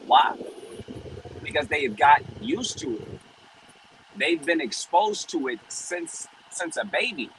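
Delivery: preaching. Acted. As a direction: preach it with rising conviction. Preaching against sin.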